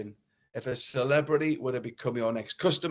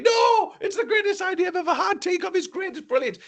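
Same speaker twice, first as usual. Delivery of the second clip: high, loud squeaky mock chorus of disbelief; mock crowd voice; 4 dB louder than usual. Audience mocking the shop owner, a high loud falsetto protest. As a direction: ham up the crowd's outcry in a falsetto. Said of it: "everybody was saying".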